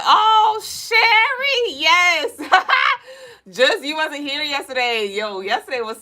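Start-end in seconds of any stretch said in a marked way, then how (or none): 0.0-2.4 high squealing cry of joy; excited squeal. Retold or imitated. Streamer squealing back at a fan's greeting. Reading a fan comment excitedly. 2.4-3.0 squealing high giggle; high laugh. Real, not acted. Streamer giggling at her own squeal.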